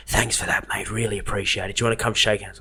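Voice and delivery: quoting Kim Short. Retold or imitated. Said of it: "He's like".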